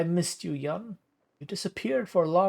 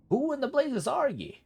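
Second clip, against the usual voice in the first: much higher cranky codger; a higher, sillier take on the old uncle; squeaky old uncle; 12 semitones higher than usual. Acted. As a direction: squeal it like a doddering hermit. Rereads Gerhard's line higher.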